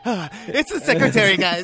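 high, laughing fake cheer; mock laughing excuse. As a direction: laugh it off too brightly. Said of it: "And he's like".

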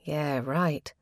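a touch of doubtful scoff; dry, understated disbelief; skeptical. Acted. Minnie doubting her sister's advice, mild skeptical scoff. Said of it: "replied Minnie skeptically".

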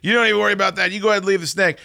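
high, fast, breezy mock expert; mock know-it-all. Guests brushing off the snake, high mock confidence. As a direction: send up the confident guys. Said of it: "everybody's acting like".

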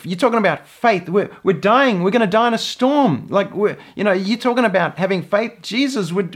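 mildly hammed-up panic; imagined disciples' protest. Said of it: "they could have said".

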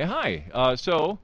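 normal talking voice

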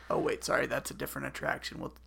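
ordinary talk

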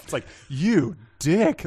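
ordinary talk, nothing marked

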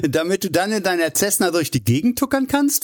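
amused, high teasing question after a chuckle; laughing. A narrator's reading. Hugh mocks his friend's little Cessna, laughing. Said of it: "fragte Hugh lachend".

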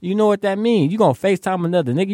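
half-acted high-pitched telling-off at his date; cussing her out. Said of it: "I cussed her out".

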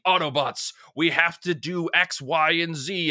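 lightly voiced heroic leader, a touch slow; a hint of rallying Optimus; Optimus Prime speech. Retelling Optimus rallying the Autobots, lightly heroic. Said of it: "him saying".

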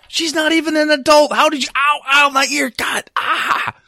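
high whining protest with ows; pained whine. Acted. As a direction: whine while ear is twisted. Ear pulled by mother.

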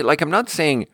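ordinary delivery

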